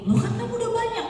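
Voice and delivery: high, fast surprised objection; surprised parent. Retold or imitated. Father protesting to his nagging child, high and fast.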